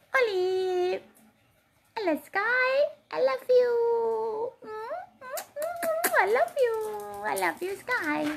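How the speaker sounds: very high squeaky pet voice; squeaky praising a dog. Real, not acted. Cooing to her dogs.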